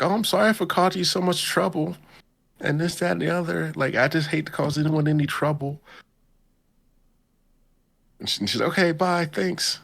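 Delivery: put-on high, fast apologetic girlish voice; mock sweet schoolgirl. Ichinose apologizing to Ayano Koji in a sugary high voice.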